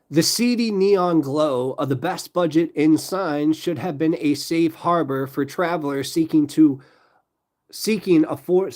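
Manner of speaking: slow, deliberate true-crime read; measured, dramatic storytelling; story narration. A narrator's reading. Reads true-crime story aloud.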